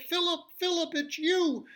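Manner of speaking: high, glad calling; joyful soldier. Acted. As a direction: call his name in relief. Soldier finds boy.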